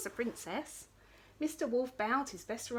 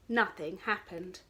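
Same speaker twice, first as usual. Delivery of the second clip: usual speaking voice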